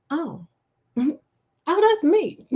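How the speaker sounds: startled, rising to a laughing cry; surprised realization. Retold or imitated. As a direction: caught off guard, blurting it out. Caught off guard, realizing she's called.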